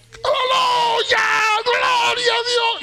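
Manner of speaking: high, drawn-out shout of praise. Retold or imitated. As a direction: shout it out with long, stretched vowels. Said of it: "yo grité".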